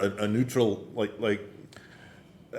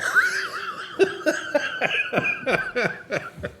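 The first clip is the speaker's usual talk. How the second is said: high squealing laugh. Real, not acted. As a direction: crack up in a shrieking laugh. Laughs after the joke.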